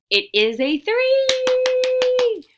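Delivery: loud, high, long-held final word; excited cheer. Real, not acted. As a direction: cheer the answer out, stretching it high. Teacher cheers the answer to children.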